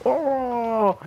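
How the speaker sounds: long, high "oh" of pain; mock pained groan. Retelling the man kicked in the groin, mock agonized groan.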